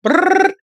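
high buzzing phone ring; phone ring imitation. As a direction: buzz like a ringing phone. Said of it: "Have me like".

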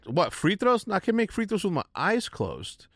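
high, scoffing and overconfident; cocky quote. Retold or imitated. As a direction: scoff like it's too easy. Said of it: "And I said".

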